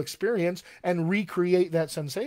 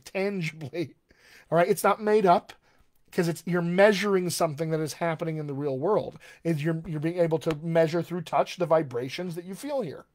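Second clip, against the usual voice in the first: ordinary delivery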